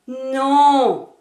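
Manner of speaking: long, loud, sustained no; big elongated no; drawn-out refusal. Shown as a demonstration. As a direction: stretch the no out loudly, then let it fall. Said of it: "de l'une de ces façons".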